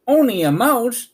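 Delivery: a tiny queen insulted; indignant cry. A narrator's reading. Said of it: "cried the little animal indignantly".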